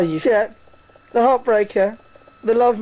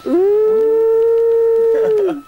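high, loud teasing ooh; a hammed-up gasp of mock shock; squealed ooh at a cheeky insult; 13 semitones higher, 6 dB louder than usual. Real, not acted. Mocking reaction to bitchy insult.

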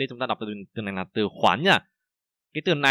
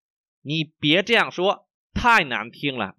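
slow, syllable-by-syllable model reading; slow, carefully enunciated Mandarin for learners; 4 semitones higher, 3 dB louder, much slower than usual. Shown as a demonstration. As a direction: read slowly so each tone is heard. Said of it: "mình đọc rất là chậm".

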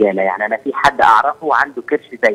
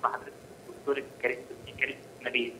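caller's voice fading far off; faint, distant phone voice; walking away; 22 dB quieter, faster than usual. Real, not acted. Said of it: "الصوت بيبعد قوي". (صوت بيبعد وبيوطى قوي)

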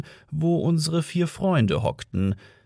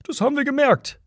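calling out; 13 semitones higher, faster than usual. A narrator's reading. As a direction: call it back sarcastically. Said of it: "rief Ford".

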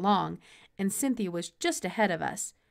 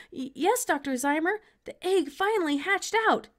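excited stammer; 10 semitones higher, 4 dB louder than usual. A narrator's reading. Boy nervous and excited.

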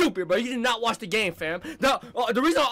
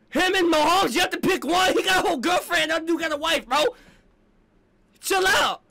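angry yelling; 5 semitones higher than usual. Real, not acted. Fan yelling at the commentator on TV, furious shouting. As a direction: shout him down, fed up.

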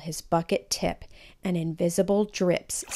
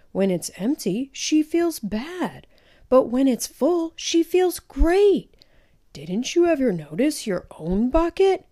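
gentle grandpa; 6 semitones higher than usual. A narrator's reading. Grandpa gently lectures Felix.

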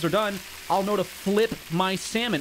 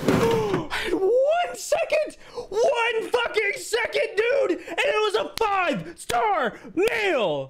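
laugh-crying shout; 14 semitones higher, slower than usual. Real, not acted. Gamer misses the order by one second, high laughing howl.

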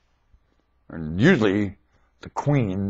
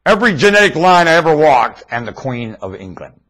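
ordinary talk

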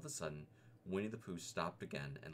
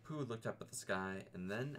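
plain reading voice

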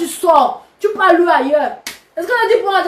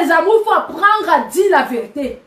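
normal talking voice

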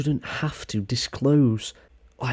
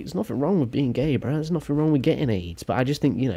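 ordinary talk

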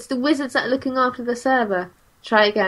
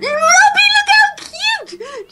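high, slow squeal of delight; excited squeal; 4 dB louder, much slower than usual. Real, not acted. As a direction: gush over something adorable. Squealing over cute robot.

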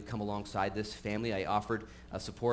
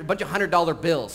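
ordinary talk, nothing marked

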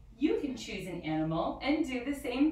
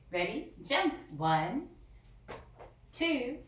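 ordinary delivery

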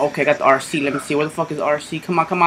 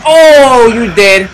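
triumphant yell; 7 semitones higher, 10 dB louder, much slower than usual. Real, not acted. Kills an enemy, yells.

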